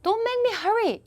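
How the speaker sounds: whiny, drawn-out protest; a mock irritated friend pleading, very high and slow; annoyed reply. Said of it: "반면에 이런 거죠".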